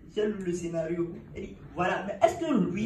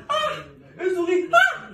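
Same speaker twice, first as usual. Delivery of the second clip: high, loud shriek at a spider; exaggerated scream of fear; mock fright; 13 semitones higher, 10 dB louder, slower than usual. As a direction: panic over nothing, hammed up. Mocking people scared of everything.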